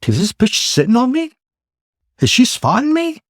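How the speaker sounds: high, rising questions of disbelief; retold incredulity. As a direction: replay the dawning suspicion, voice shooting up. Retold dawning suspicion, questions to himself.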